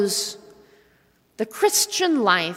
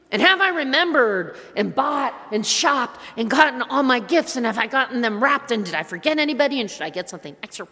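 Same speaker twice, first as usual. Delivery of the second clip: rushed worrying; faster than usual. Retold or imitated. Frantic holiday to-do list.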